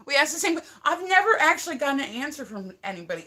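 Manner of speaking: loud, high-pitched protest; worked-up complaint; exasperated venting. Real, not acted. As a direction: raise the voice in amused frustration. Vents about never getting answers.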